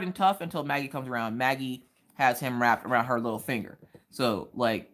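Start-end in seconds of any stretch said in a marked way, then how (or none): none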